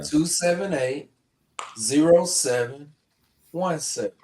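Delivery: normal talking voice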